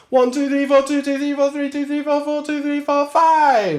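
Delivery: counting high over held note. Shown as a demonstration. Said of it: "holding a note for four bars".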